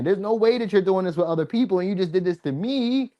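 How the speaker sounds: normal talking voice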